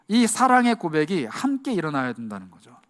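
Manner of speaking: normal talking voice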